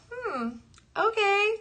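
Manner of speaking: high-pitched, amused self-quote; playful acceptance. Retold or imitated. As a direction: warm to the idea in a high voice, tipping into laughter. Acting her pleased acceptance, then laughs.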